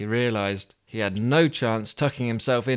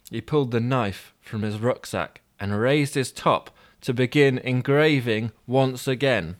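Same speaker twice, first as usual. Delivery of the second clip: plain reading voice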